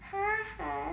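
usual speaking voice

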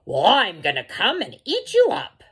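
loud, high-swooping troll threat; menacing troll. A narrator's reading. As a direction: threaten to eat the goat, loudly. Troll threatens the medium goat.

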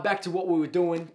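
ordinary talk

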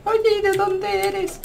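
normal talking voice

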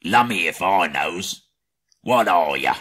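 a hint of a puzzled troll questioning; mild gruff troll query. A narrator's reading. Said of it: "said the others".

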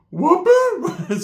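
high, loud, drawn-out wail; mock outcry. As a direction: stretch it into an imitated shocked yell. Primo's repeated outcry, retold.

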